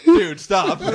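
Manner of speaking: the little hamster begging, laughing. Retold or imitated. Hamster on the brain's wheel begs him to stop, loud and laughing. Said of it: "hamster on the wheel's like".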